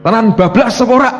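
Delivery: high, loud mock questioning; imagined prayer question. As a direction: raise the voice as if demanding an answer. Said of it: "saya bilang gitu".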